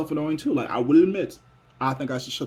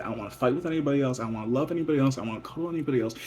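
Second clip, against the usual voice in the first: ordinary talk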